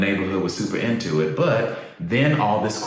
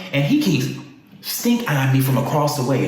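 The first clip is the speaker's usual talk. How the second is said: ordinary talk, nothing marked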